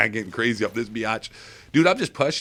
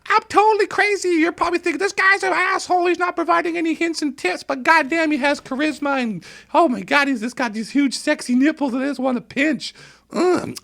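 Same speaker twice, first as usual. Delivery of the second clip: much higher, wild showman voice; manic hype voice; 3 dB louder than usual. Acted. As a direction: crank it up like a crazed hype man. Hyped self-praise in put-on voice.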